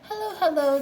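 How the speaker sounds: high, loud cheerful twins' hello; bright twin greeting. A narrator's reading. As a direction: greet him as chirpy twins. Twins greeting, doubled speech.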